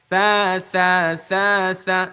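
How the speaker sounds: drawn-out, lisped "সা"; tongue-out drill. Shown as a demonstration. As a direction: hold each "সা", tongue tip between the teeth. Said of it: "জিহ্বা কিন্তু বাইরে".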